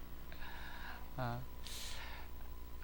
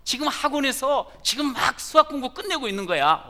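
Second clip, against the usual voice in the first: put-on much higher, loud boastful kid; proud and confident; 12 semitones higher, 9 dB louder than usual. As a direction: answer with a proud swagger. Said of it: "얼마나 떳떳하고 당당한지".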